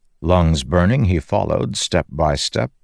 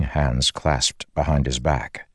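ordinary delivery

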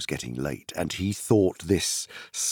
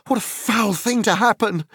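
higher, louder, frantic cry; wild cry; 14 semitones higher, 4 dB louder than usual. Acted. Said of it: "cried Frodo wildly".

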